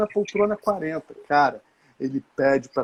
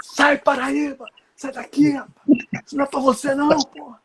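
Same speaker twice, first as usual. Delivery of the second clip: mock yelling; 11 semitones higher than usual. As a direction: shout him away from the curtain. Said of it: "Imagina os berros".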